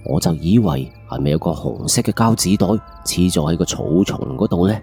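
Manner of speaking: usual speaking voice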